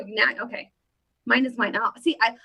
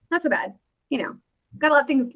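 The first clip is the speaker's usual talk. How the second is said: ordinary talk, nothing marked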